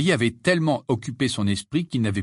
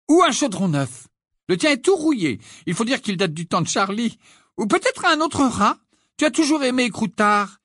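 much higher, cooing mother listing gifts; a proud mum spoiling her son; mother fussing over her son, voiced high; high doting mother; 12 semitones higher, 4 dB louder than usual. A narrator's reading. Mrs Weasley voiced high.

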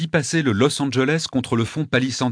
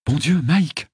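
higher, dismayed outburst; alarmed exclamation; 6 semitones higher, slower than usual. A narrator's reading. Narrator seeing Mike bleed, alarmed. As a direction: cry out at a friend's wound.

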